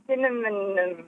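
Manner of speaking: cinnamon said as "cinnamanum"; struggled word. Real, not acted. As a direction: add an extra syllable to cinnamon. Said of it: "struggle with".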